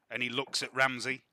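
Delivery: normal talking voice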